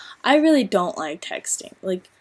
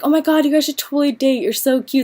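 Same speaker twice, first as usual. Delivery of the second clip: gushing friend; 5 semitones higher than usual. Retold or imitated. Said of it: "they're always like".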